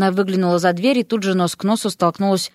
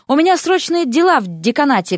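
hurried call, pitched up; lightly acted cry, higher than usual; mild narrated shout; 6 semitones higher than usual. A narrator's reading. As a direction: excuse yourself in a rush. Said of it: "крикнул он и вылетел за дверь".

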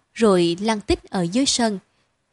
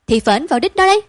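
light teasing jab, a bit higher; joking teasing; 5 semitones higher, 6 dB louder, faster than usual. A narrator's reading. Father teasing mother about the boy, jokingly. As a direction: tease her with a grin. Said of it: "Cậu cười, nói đùa mợ".

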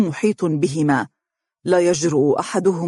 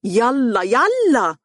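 higher, louder impatient yell; 7 semitones higher, 3 dB louder, much slower than usual. A narrator's reading. Stepmother yelling at Mohra, loud impatient yell. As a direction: shout at her to move. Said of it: "تصرخ بها".

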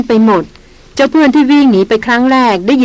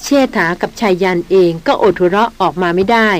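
ordinary delivery

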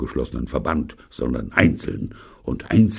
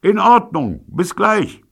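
calling across; 6 dB louder, slower than usual. A narrator's reading. Shouting across street.

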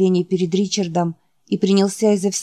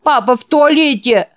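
put-on high, loud child's cry; frightened scream; 7 semitones higher, 6 dB louder than usual. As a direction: cry out in panic from a nightmare. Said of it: "закричал Ричард". (испуганный детский крик)

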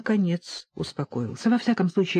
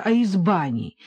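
plain reading voice

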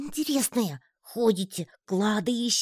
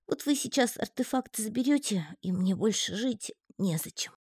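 грустный паучок; 7 semitones higher, 3 dB quieter than usual. A narrator's reading. Паучок горюет об артефакте.

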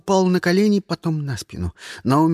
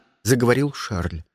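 usual speaking voice

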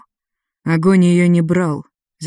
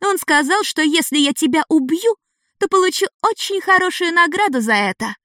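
put-on high, childlike girl voice; a child proud of her prize; eager young girl; 11 semitones higher than usual. A narrator's reading. Ada boasts of reward.

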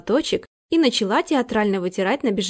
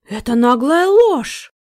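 hoarse; 5 semitones higher, 5 dB louder, slower than usual. A narrator's reading. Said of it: "прохрипел Дворецкий".

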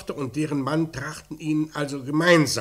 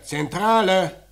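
loud, slow, higher shout of Zentrale; calling out; 5 semitones higher, 5 dB louder, slower than usual. Acted. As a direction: insist on getting the operator. Calling the operator urgently. (lauter, langsamer Ruf nach der Zentrale)

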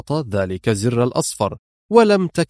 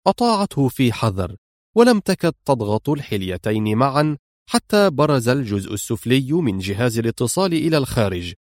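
ordinary delivery

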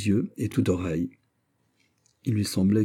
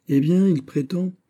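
high-pitched prince; indignant prince; 11 semitones higher, 4 dB louder than usual. A narrator's reading. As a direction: rise up in petulant outrage. Prince voiced in narration. (prince indigné, voix aiguë)

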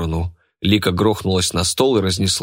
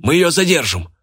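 high-pitched rallying call; urgent shout; 8 semitones higher than usual. A narrator's reading. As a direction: shout it over a brawl. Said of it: "крикнул он". (крик в пылу драки)

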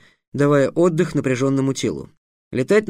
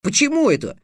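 put-on high indignant boy flaring up; a boy bristling at the insult, voice up high; 8 semitones higher than usual. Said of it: "вскинулся Халанбери".

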